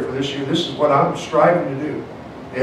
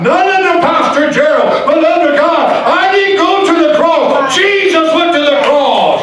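loud, high, building to a shout; fervent revival preacher in full cry; full-force preaching; 7 semitones higher, 6 dB louder than usual. Real, not acted. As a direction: preach it harder and higher till you are shouting.

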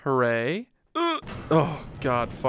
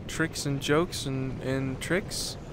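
ordinary delivery

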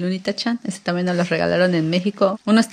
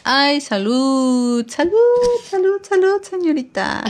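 playful bless you; 7 semitones higher, 3 dB louder, slower than usual. Acted. Blessing baby's sneeze playfully.

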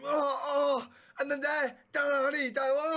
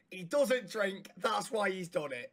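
ordinary talk

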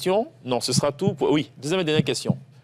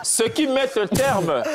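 laughing; 8 semitones higher, slower than usual. Real, not acted. Host laughs closing the round.

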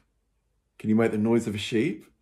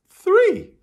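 much higher, louder shout of three; big excited shout; 6 dB louder than usual. Real, not acted. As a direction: cheer out the final count. Counting to three with children.

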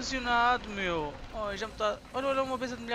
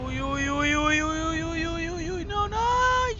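a run of yelps building higher and louder; rising panic; 4 semitones higher, 5 dB louder than usual. Real, not acted. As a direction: climb into panic yelp by yelp. Falling in game, rising panic.

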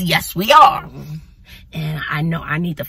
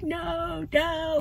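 startled high no; 11 semitones higher, 8 dB quieter than usual. Real, not acted. Startled seeing a student.

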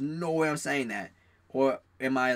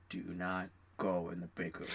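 retold warning, low and hushed; 8 semitones lower, 10 dB quieter than usual. Friend warns him off the break room, low warning. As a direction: drop low and soft, like a grave tip-off.

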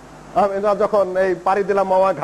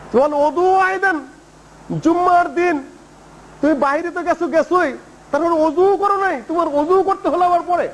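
high-pitched, indignant telling-off; retold scolding; 7 semitones higher than usual. Caliph rebukes a latecomer in the Friday sermon, scolding. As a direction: imitate a stern public rebuke. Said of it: "ধমক দিলেন যেভাবে".

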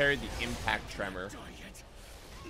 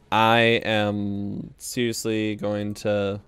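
ordinary talk, nothing marked

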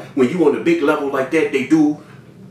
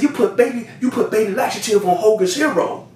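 higher-pitched incredulous accusation; retold outrage; 8 semitones higher than usual. Confronting supplier about cutting the product, retold incredulous accusation. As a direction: repeat it high, mock scandalized.

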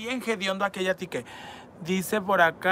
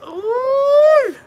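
shriek; 9 dB louder than usual. Real, not acted. Reacts to crude comment.

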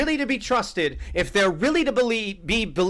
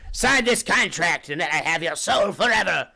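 devil voice. Retold or imitated. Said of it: "What are you, Satan?".

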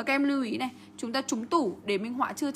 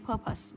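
PUR-pus, short weak ending; stress on first syllable; 5 dB quieter than usual. Shown as a demonstration. Teacher modelling the word, purpose stressed as PUR-pus. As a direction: purpose with the stress up front, second vowel a schwa. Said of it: "trọng âm rơi vào âm tiết đầu tiên".

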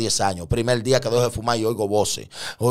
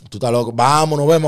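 raised, high brush-off; dismissive disbelief; 6 semitones higher, 7 dB louder than usual. Retold or imitated. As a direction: wave off the crazy talk. Dismissive reaction to his news.

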